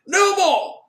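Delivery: high, loud final outburst; angry shout. Acted. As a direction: bark it as a last word before leaving. Doctor storms off ending sittings.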